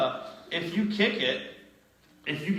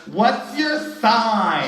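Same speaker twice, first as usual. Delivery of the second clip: drawn-out, high mock holler; loud drunken interruption; 7 semitones higher, 8 dB louder, much slower than usual. Drunk guest butting into an interview, loud drawn-out holler.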